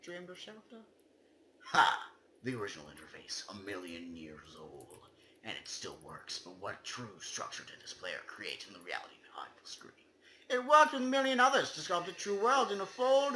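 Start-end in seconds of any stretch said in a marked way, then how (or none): 3.5-9.9 rushed, hushed whisper; fast whisper. Acted. Reading the poem's eerie line to friends, rushed whisper. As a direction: hurry it out under your breath.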